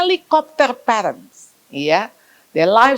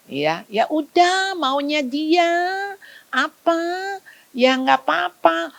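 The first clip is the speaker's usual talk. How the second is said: lazy parent; 7 semitones higher, slower than usual. Retold or imitated. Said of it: "laissez-faire parent".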